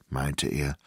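plain reading voice

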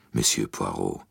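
lightly voiced imperious old lady demanding an answer; a hint of a cold old princess; stern old princess. The princess challenges Poirot.

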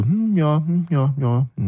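high, noncommittal mock approval; polite murmuring. Tasters politely accept warm wine, high hesitant hums. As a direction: imitate guests humming along out of politeness. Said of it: "Aus Höflichkeit".

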